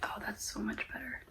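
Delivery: soft unvoiced whisper, close to the mic; hushed ASMR whisper. Real, not acted. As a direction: breathe the words right into the listener's ear.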